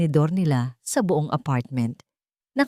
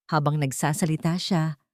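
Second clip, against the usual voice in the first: ordinary delivery, nothing marked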